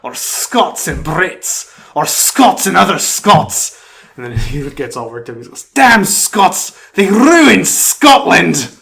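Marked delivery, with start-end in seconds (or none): 0.0-3.8 slow Scottish brogue building louder and higher; Scottish accent rising into a loud rant; Groundskeeper Willie Scottish accent. Retold or imitated. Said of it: "That was a really good accent". 5.7-8.8 loud, high Scottish bellow, slow on the last line; worked-up Scotsman yelling in a thick brogue; Groundskeeper Willie furious Scottish. Retold or imitated. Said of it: "That was really good".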